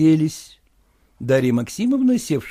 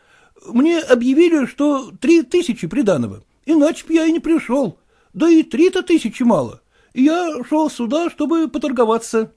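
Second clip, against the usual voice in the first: высокий голос жалобного молодого просителя; жалуется генералу на тяжёлую жизнь; голос Куцина; 9 semitones higher, 3 dB louder than usual. Acted. Kutsin voiced. (молодой проситель Куцин жалуется генералу, голос выше)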